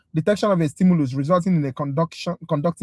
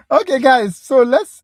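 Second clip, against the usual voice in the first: calling out; 8 semitones higher, 9 dB louder, slower than usual. Real, not acted. Calling the class to attention.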